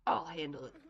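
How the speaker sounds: usual speaking voice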